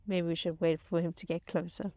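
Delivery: normal talking voice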